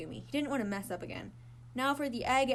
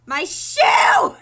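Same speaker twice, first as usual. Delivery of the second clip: loud high wail; distressed shout; 9 semitones higher, 17 dB louder than usual. Acted. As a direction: cry out in dismay. Egg lands on his shoes.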